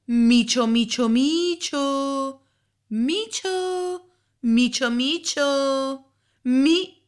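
high, loud, slow sing-song call; calling a cat. A narrator's reading. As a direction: coax the kitty from behind the corner. Boy calls to the tiger. (richiamo acuto e cantilenante, forte e lento)

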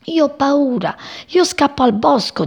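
scared little birds, mildly acted; frightened. A narrator's reading. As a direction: confess fear, wanting to flee. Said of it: "tremavano di paura".

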